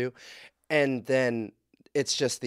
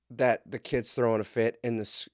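usual speaking voice

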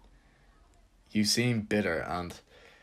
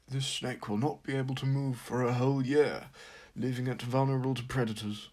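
a hint of David Attenborough; lightly voiced nature narrator; nature documentary; 3 semitones higher than usual. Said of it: "David Attenborough says something like".

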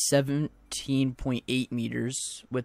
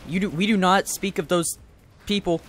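a hint of a stern mother, louder; mock scolding mother; 4 semitones higher, 6 dB louder than usual. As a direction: lightly play an angry mom. Scolding as his mother figure.

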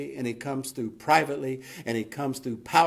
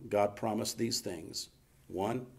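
ordinary talk, nothing marked

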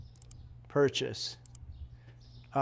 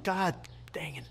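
whispered curse; 4 semitones lower, 9 dB quieter than usual. Real, not acted. Muttering about the missing eggs.

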